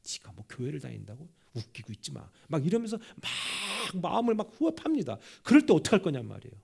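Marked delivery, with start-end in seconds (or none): 0.0-2.3 sneering mock. Sneering quote from a mocker.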